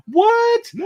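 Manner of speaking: very high, hammed-up shriek of disbelief; mock amazement. Co-host hyping four creators in one night, mock amazement. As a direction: squeal it in exaggerated shock.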